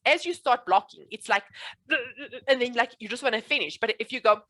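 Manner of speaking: ordinary talk, nothing marked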